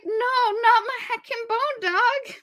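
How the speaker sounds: high, slow, whiny wail; whiny plea. Acted. Mara pleading for her dog, slow high whine. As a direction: beg like a kid losing her pet. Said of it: "Mara's like".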